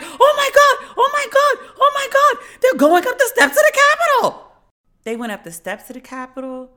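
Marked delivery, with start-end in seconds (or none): 0.0-4.5 very high, loud shriek of disbelief; mock shrieking panic. Comic reenacting his own panic at the news, shrieking high. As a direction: squeal it like the feed just caught up. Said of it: "And I'm like".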